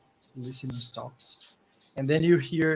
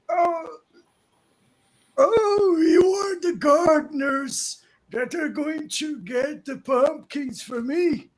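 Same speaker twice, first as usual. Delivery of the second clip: put-on much higher, loud delighted old man shouting; excited old man; 13 semitones higher, 10 dB louder than usual. Old man thrilled the gardeners finally came. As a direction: cry out in relief like an old man.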